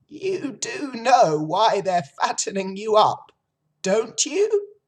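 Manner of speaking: put-on high, loud, knowing old sheep. A narrator's reading. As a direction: press the pig as the old sheep.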